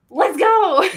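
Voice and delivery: loud hype cheer; excited. Retold or imitated. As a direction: pump yourself up, loud. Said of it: "that I'm like".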